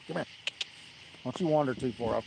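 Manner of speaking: ordinary talk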